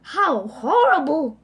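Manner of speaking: loud disgusted exclamation. Acted.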